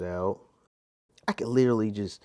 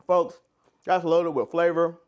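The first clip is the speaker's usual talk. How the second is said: talking through a mouthful; mouth full; 7 semitones higher, 5 dB louder than usual. Real, not acted. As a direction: speak round a bite of soup. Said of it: "I know I'm talking with my mouth full".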